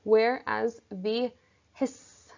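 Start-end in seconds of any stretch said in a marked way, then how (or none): 1.7-2.3 "hiss" slowly, with a sustained final S; drawn-out hiss sound. Shown as a demonstration. Teacher explains stressed sibilants, long S in "hiss". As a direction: stretch out the "ss" in "hiss". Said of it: "hiss sound is always treated as stressed".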